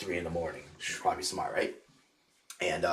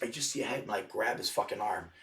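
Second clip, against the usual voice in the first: normal talking voice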